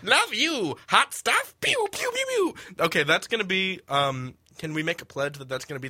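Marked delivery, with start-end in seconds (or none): 0.0-2.6 high, teasing villain voice firing toy lasers; cheesy villain flirt. Acted. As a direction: croon it then go pew pew.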